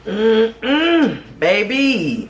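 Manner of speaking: high, pleased hum of delight; savoring delight. Real, not acted. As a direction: relish the taste out loud. Savoring after the bite.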